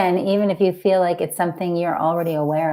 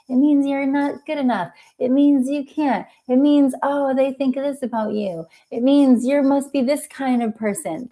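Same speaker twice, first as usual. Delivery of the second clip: higher-pitched voice of an anxious inner self; small me; 6 semitones higher than usual. Acted. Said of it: "That's the small me".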